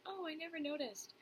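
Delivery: high-pitched mock reassurance; imitating friends and family. Friends or family after hearing the whole spiel, high-pitched imitation. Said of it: "they're like".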